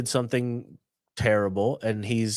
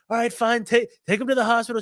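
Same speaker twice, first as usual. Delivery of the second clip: high voice; 10 semitones higher, faster than usual. Retold or imitated. Voicing the giving-up man.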